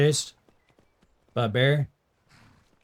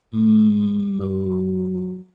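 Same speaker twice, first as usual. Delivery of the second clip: long, high, loud moo; high cow moo; 9 semitones higher, 4 dB louder than usual. Acted. Streamer trying a higher moo. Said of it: "I can do a pretty good cow".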